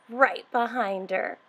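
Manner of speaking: plain reading voice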